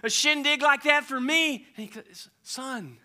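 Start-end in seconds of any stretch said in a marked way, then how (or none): 0.0-1.7 loud, higher complaint; angry son. Acted. Son confronting his father, loud complaint. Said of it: "and he's angry". 2.4-3.0 calm a hurt son; gentle father. Acted. Father to resentful son, gentle and slow.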